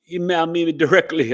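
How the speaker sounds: a touch of West Indian lilt on "email me directly"; West Indian accent. Real, not acted. Host giving email to viewers, accidental West Indian accent. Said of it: "I went into the West Indian accent by accident".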